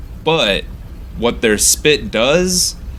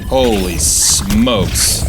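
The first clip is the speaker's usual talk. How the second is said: slow amazed; much slower than usual. Real, not acted. Relief after the fight.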